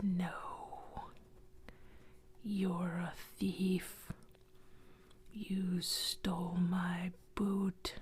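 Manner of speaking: slow, menacing ghost whisper; lightly voiced accusing ghost. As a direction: draw out the accusation like something dead. Ghost accuses boy of theft.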